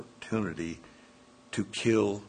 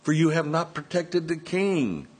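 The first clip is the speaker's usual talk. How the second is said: raised, high call to Abner; crying out; 6 semitones higher than usual. Acted. David calling across to Abner, a raised high cry. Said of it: "cries out to Abner".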